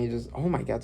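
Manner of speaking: ordinary talk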